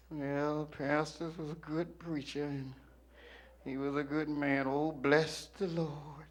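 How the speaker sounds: imitating mourners, quiet and drawn-out; mock mourners. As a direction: murmur a hammed-up tribute over the coffin. Imagined mourners at pastor's funeral.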